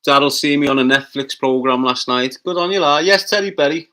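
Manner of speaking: loud cheery call-out; loud greeting. Real, not acted. Streamer shouting out viewers by name, loud and cheery.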